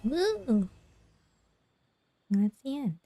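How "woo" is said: high, light woo; soft high-pitched whoop; cheer. Real, not acted. Streamer cheers at the end.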